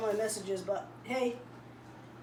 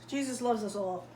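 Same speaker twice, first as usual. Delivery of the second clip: normal talking voice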